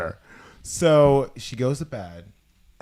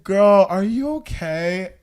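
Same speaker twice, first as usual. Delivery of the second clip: startled; 6 semitones higher, 6 dB louder, slower than usual. Retold or imitated. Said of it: "and I was like".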